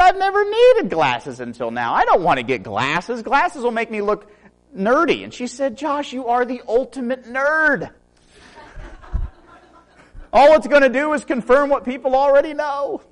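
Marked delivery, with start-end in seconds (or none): 0.0-5.2 whiny protest. Retold or imitated. His retold whining protest. 5.8-13.0 high, laughing jab; teasing wife. Retold or imitated. Wife ribbing her husband Josh, in a high, laughing tease.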